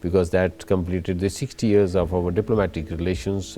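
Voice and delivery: normal talking voice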